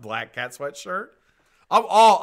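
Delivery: normal talking voice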